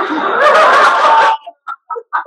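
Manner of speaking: shrieking burst of laughter; loud high laugh. Real, not acted. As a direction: let the laugh explode. Laughing at the scene.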